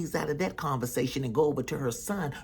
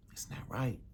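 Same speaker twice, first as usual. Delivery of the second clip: ominous whisper; mother whispering a warning about the house; 5 semitones lower, 11 dB quieter than usual. Acted. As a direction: hush it like a warning.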